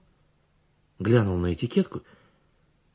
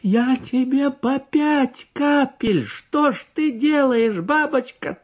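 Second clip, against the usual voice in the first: high, very slow, choked; high strangled horror; aghast doctor; 3 dB louder, much slower than usual. A narrator's reading. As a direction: plead in horror.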